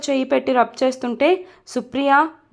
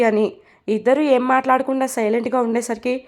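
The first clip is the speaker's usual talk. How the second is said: usual speaking voice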